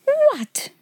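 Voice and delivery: a high, breathless whispered "What?"; shocked cry. Acted. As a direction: gasp the word out in disbelief. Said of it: "she cried".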